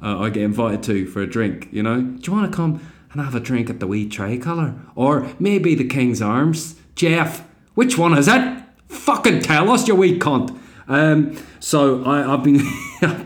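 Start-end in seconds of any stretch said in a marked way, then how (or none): 2.0-10.6 put-on Belfast accent building to an angry higher shout. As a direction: Belfast local growing aggressive, rising in pitch. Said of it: "Belfast local demanding an answer".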